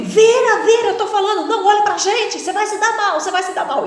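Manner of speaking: high, loud threatening yell; robbers shouting. Retold or imitated. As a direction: scream threats at her. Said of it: "eles gritando comigo". (gritos ameaçadores de bandido)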